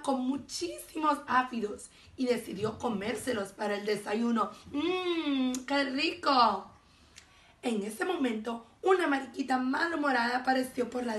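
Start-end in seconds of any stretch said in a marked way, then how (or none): 4.7-6.7 drawn-out, relishing mmm; slow, lip-smacking pleasure at food; savoring delight. A narrator's reading. Narrator voices ladybug eyeing aphids for breakfast, savoring.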